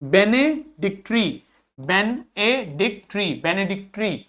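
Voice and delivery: बेनेडिक्टरी very slowly, be-ne-dik-tree; slow down on बेनेडिक्टरी for learners; slow pronunciation drill. Shown as a demonstration. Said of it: "आगे देखिए". (बेनेडिक्टरी धीरे, साफ़-साफ़)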